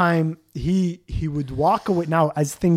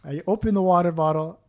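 normal talking voice

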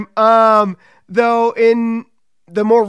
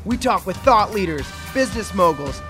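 podcast announcer read; 3 semitones higher than usual. Acted. Scripted show intro over music.

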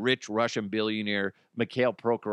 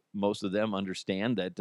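ordinary delivery

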